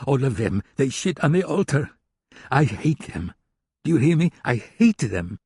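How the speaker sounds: a hint of a contemptuous German hacker lecturing. German hacker rants about hackers.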